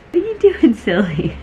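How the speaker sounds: high squeaky pet talk. Real, not acted. Talking to her excited cat.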